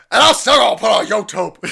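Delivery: loud, high laughing shout; laughing quote. Real, not acted. Mimicking the ranter while laughing, high loud. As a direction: yell it through laughter.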